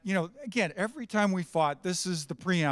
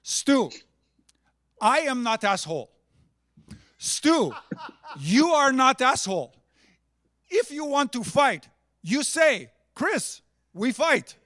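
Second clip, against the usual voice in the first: higher and louder mock Chris in broken English; imitating Chris; 6 semitones higher, 5 dB louder, slower than usual. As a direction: ham up the teammate's blunt logic, slowing down on the last line. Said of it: "And he goes as follows".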